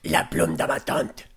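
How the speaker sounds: low mock-demonic French; demon quote. Podcaster repeating the possessed girl's French, low demon voice.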